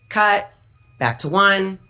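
ordinary delivery

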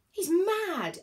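high, loud shout over the wind; shouting over noise. A narrator's reading. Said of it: "shouted above the noise of the wind".

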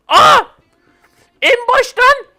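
loud high yell of disbelief; shocked shout. Real, not acted. Gamer sent back to the start, shocked shout. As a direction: cry out as everything resets.

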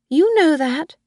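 the old mother reassuring her son, voiced; old Mrs Harvey. Old woman voiced by reader.

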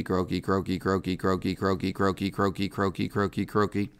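soft rapid croaking chatter; imitating a rapid frog trill. Shown as a demonstration. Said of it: "Hya chrysocellus goes Croaky".

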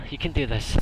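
ordinary delivery

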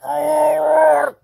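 high, loud desperate shout; panicked cry for help. Acted. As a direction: scream for your sidekick. Hero in danger calls out.

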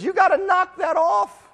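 high-pitched, insistent imitation of a divine telling-off; acted high-voiced reprimand; mock exasperated scolding. God scolding the people, voiced by preacher.

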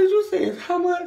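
high, loud incredulous squeal; disbelief. Retold or imitated. To the cashier, squealing in disbelief at the price. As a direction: shriek the question in shock.

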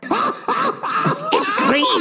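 high-pitched mocking customer voice; demanding customer. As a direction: screech it like an entitled shopper.

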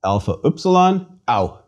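Alpha upsilon voiced as ow; Greek diphthong. Shown as a demonstration. Teacher models the diphthong for repeating. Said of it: "Please repeat".

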